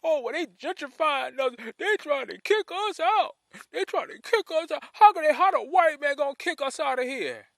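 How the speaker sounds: high-pitched mocking whine of protest; mock complainers. Host mimics people who object to gentrifying, mocking and high. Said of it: "And people always say".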